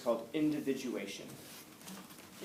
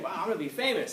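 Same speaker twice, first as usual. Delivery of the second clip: higher-pitched imitation of an eager reporter; happy, excited; 6 semitones higher, faster than usual. Reporter thrilled about the story he will report, happy and excited. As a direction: play a reporter thrilled with himself, lightly. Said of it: "he's, like, all happy".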